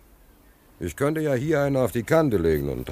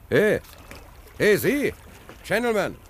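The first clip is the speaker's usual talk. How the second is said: calling out; 12 semitones higher than usual. Acted. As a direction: call out to get a stranger's attention. Calling out to a passing policeman.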